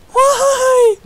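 very high, loud wailed question; mock wail. Streamer bemoaning being compared to others, mock wailing. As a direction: wail it out like hammed-up crying.

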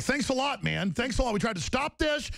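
high, bitter sarcastic thanks; sarcastic mock quote. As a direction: throw their blame back at them. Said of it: "It's like".

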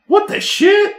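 high squeak of disbelief; a startled yelp of shock. Retold or imitated. Retold shock.

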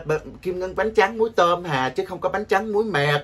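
usual speaking voice

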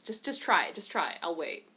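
usual speaking voice

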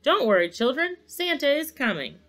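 high, cheerful announcement; cheerful shout. A narrator's reading. Santa reassures the children he is coming, cheerful call. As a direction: call out brightly to faraway children. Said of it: "he shouted cheerfully".

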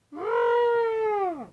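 drawn-out, high-pitched falling scream; long high scream. Acted. All swept away as house collapses.

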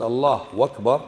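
"الله أكبر" said as "Allah wakbar"; "أكبر" wrongly turned into "wakbar"; added waw. Shown as a demonstration. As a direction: put a w at the start of "أكبر". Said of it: "زدت حرف الواو".

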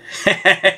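brief chuckle. Real, not acted. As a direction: chuckle before the sign-off. Chuckles during the sign-off.

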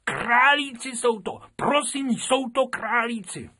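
an eager pupil calling out to the teacher; schoolgirl crying out. Acted. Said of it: "vykřikla zase Krupičková". (vykřiknutí holčičky, mnohem výš a hlasitěji)

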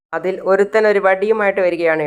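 ordinary delivery

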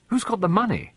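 put-on high, eager boyish demand; insistent schoolboy question. A narrator's reading. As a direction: demand an answer at once. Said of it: "demanded David".

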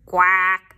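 a mother duck's call; loud duck quack. Acted. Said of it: "says the mother duck".

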